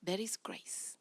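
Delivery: soft, slow whispered grace; a parent granting a later bedtime in a whisper; gentle whisper. Retold or imitated. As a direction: hush it kindly to the child. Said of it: "you're going to say".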